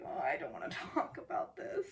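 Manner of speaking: low, breathy mutter of dread; dreading what comes next; reluctant groan. Real, not acted. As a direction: shrink away from the topic. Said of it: "I'm just like".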